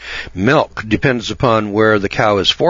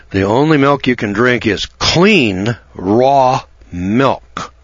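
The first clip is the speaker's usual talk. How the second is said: clean said slightly higher and leaned on; stress on clean; 3 semitones higher, slower than usual. Shown as a demonstration. Radio host stressing to listeners that only clean milk is safe, stress on clean. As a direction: lean on the word clean. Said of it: "And I emphasize the word clean".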